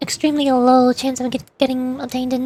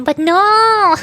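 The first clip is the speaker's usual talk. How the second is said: drawn-out, higher, louder wail of protest; dramatic lament; 6 semitones higher, 5 dB louder than usual. Real, not acted. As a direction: lament your bad luck loudly, theatrically. Mock outrage at missed banner pull.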